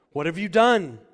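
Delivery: loud, high preached question; loud rebuke. Acted. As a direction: thunder the question. God rebukes Saul.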